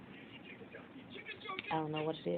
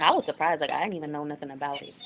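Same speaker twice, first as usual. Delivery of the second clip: normal talking voice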